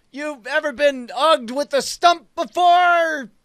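slow, high-pitched hollered threat; acted shout. Attacker taunting his victim in the game, shouting slowly. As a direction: shout it out, drawing the words long. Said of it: "I shout, like".

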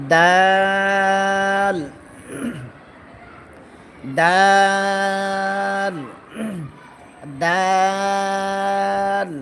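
each দাল held very long on a level note; slow recitation drill, light vowel in দাল; thin, drawn-out dal. Shown as a demonstration. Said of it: "দাল পাতলা হবে". (দাল পাতলা, টেনে টেনে)

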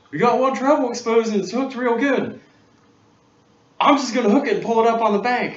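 high, loud bossy fisherman; cocky, sure of himself, loud and high; the helper calling out his plan; confident helper voice. Retold or imitated.

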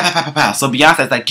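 usual speaking voice

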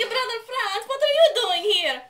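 high, loud whiny little-brother voice; Italy. Acted. Cosplayer greets her friend as Italy, high and loud. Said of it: "You're Italy".

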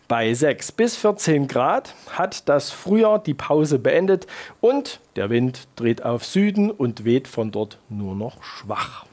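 ordinary delivery

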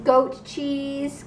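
put-on goat cheese said slowly for the child; "goat cheese" 천천히 보여 주듯; slow showing. Shown as a demonstration. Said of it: "Can you say goat cheese?".

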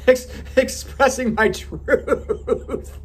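high, slow laughing correction; correcting a slip while laughing; laughing at own slip. Real, not acted. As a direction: giggle through the fix.

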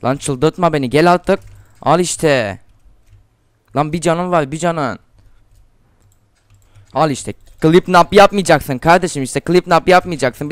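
real angry shouting at an enemy player; frustrated yelling. Real, not acted. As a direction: yell at the opponent in real frustration. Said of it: "bağırtma bana, bağırtma".

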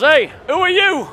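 a stranger stopping the comedian at the door, challenging him. Retold or imitated. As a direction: call him out like a suspicious bloke. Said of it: "he goes".

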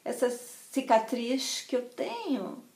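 put-on soft, slow Rio accent; gentle carioca. Friend confides about her own scar, gentle carioca accent. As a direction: imitate a soothing, lilting carioca manner. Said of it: "ela com aquele jeitinho que eu tenho morro de inveja".

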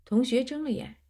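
plain reading voice

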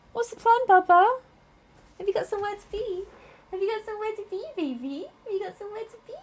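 soft high coo. Real, not acted. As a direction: coo gently at the pet. Cooing to the pet.

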